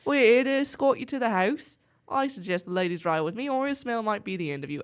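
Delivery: put-on Aussie accent slipping into English; attempted Australian accent. Sister escorting guests, Australian accent drifting into English. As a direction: aim for Australian, bleed into British. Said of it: "Am I just doing English?".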